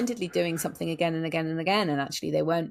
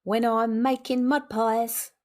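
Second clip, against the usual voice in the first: [name] style; 5 semitones higher, slower than usual. Acted. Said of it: "[name] esque".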